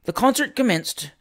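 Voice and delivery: ordinary delivery, nothing marked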